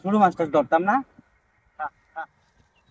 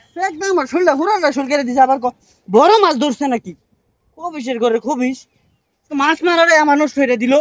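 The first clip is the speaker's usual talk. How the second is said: loud, raised complaint; angry rant; 4 semitones higher, 8 dB louder than usual. Real, not acted. Fisherman ranting that his fishing was ruined, loud anger. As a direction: rage about the ruined fishing.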